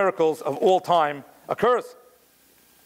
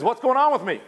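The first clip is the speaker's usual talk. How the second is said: higher-pitched, imitated bafflement; bewildered; 6 semitones higher than usual. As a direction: voice a man baffled by his own tears. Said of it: "he's bewildered why he's crying".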